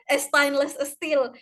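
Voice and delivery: slow, imitated Iranian "e-stainless e-steel"; Iranian accent. Student reading out her notes in the lecturer's Iranian accent. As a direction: put an E before stainless and steel, Iranian-style. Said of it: "logat Irannya tuh kental banget".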